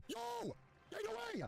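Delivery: very high celebratory cry; ecstatic disbelief. Real, not acted. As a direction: burst out high in happy disbelief. Celebrating a right answer.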